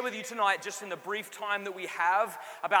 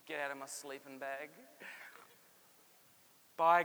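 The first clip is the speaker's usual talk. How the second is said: ordinary delivery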